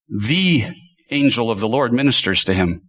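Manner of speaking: "the" said as "thee", followed by a pause; stress on THE. Shown as a demonstration. Preacher singles out the definite article, "the" stressed as "thee". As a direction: set "the" apart as a long, weighty "thee". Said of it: "the angel of the Lord with a definite article".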